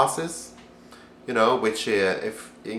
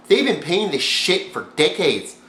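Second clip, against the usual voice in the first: ordinary talk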